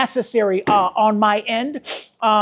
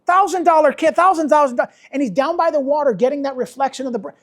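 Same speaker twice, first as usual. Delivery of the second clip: ordinary talk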